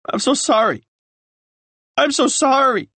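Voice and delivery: high, pleading, breaking with grief; sobbing apology. A narrator's reading. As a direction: beg forgiveness through tears.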